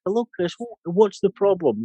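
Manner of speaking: mock delicate voice. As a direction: take the wife off in a high, sweet, dainty voice. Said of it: "or I should say in a delicate voice".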